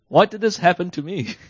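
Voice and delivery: a hammed-up why me; pissed off. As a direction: play the wronged victim, voice up. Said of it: "You're, like, pissed off".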